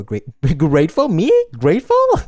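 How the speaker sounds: as if the word could never be hers; mock disbelief. Mocking her own word choice.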